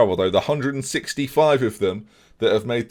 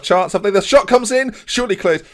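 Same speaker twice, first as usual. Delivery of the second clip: high, loud burst of play-by-play; alarmed commentary; 10 semitones higher, 6 dB louder than usual. Real, not acted. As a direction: call the dangerous shot urgently. Opposition shot in live match.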